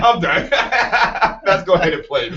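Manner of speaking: real laughter breaking into the words; cracking up while moving the show along; laughing. Real, not acted. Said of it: "I'm laughing right now".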